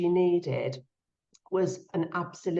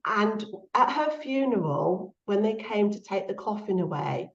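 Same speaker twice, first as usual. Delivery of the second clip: ordinary talk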